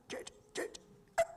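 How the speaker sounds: airless whispered call for help; breathless call. Retold or imitated. Crushed by the anaconda, calling for J J breathlessly. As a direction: squeeze the name out with no breath. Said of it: "and I can't breathe".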